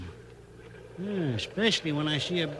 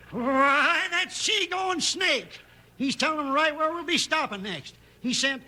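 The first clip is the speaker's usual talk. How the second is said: furious shout, slow on the insult; outraged shout; 10 semitones higher, slower than usual. Acted. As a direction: blow up at the traitor. Captain discovers traitor.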